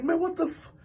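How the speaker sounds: high, soft, incredulous protest; mock bewilderment. Man stumbling after the hit, high baffled protest. His confused reaction, retold.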